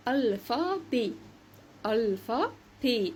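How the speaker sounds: "الفا بي" slowly, ending in é; French pronunciation demo. Shown as a demonstration. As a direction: model "الفا بي" with a silent final t. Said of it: "اخرها او تي انطقها ايه".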